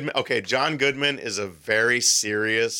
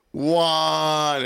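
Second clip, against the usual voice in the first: long, higher-pitched mock holler; mock yell; 6 semitones higher, 3 dB louder than usual. Translator answering how to say off, drawn-out mock yell. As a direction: draw it out as a retold yell. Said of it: "he yells Juan".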